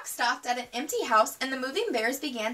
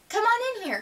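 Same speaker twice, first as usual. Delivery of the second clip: higher call across the distance; 9 semitones higher than usual. A narrator's reading. Lizzie calling Sister into the garage, a higher call. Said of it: "called Lizzie from the garden".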